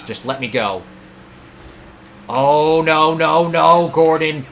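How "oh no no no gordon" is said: loud, drawn-out wail of real dismay; alarmed cry. Real, not acted. Driver wailing at Gordon mid-race, alarmed. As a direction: cry out as the crash looms. Gordon's car threatens another crash.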